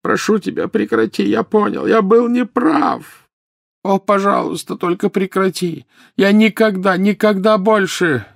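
tearful high-pitched pleading; anguished begging. A narrator's reading. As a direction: beg in torment, voice straining upward. Sobbing Dumbledore begs for it to stop.

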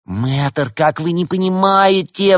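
high, loud, drawn-out plea; wailing, exasperated entreaty; imploring. A narrator's reading. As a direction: beg to be understood. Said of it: "взмолилась Валерия".

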